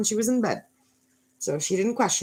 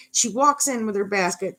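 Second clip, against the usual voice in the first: ordinary delivery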